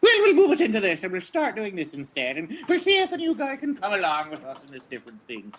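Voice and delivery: high, laughing put-on voice; hammed-up rival actor; mock actor voice. Rival actors switching voices to test the newcomer, high and laughing. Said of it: "check you out and then go".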